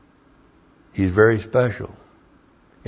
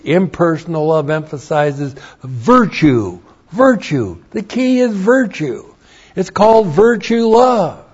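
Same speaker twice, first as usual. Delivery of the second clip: rising higher and louder on virtue; building preaching; 9 semitones higher, 6 dB louder than usual. Acted. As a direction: build each virtue to a peak.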